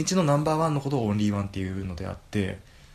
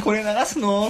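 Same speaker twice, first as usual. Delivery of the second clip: high, loud dismayed quote; mock dismay; 11 semitones higher, 5 dB louder, slower than usual. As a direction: squeak out the horror. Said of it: "っつって".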